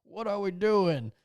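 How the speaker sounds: hammed-up exasperated protest; mock pissed-off dudes. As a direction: imitate men appalled by the music. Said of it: "all these dudes are all pissed".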